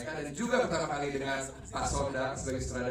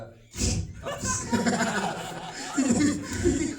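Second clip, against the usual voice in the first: burst of laughter; 7 dB louder than usual. Real, not acted. Laughs during own speech.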